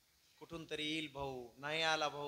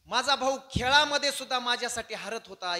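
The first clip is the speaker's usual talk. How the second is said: raised, louder plea; impassioned lament; 7 semitones higher, 11 dB louder than usual. Acted. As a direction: lament it loudly with pitch lifted. Bharat's imagined lament, acted.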